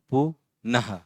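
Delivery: "पुनः" as two separate syllables, final h released; split syllables. Shown as a demonstration. Teacher sounding out "पुनः", syllable by syllable. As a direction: break "पुनः" after the first syllable.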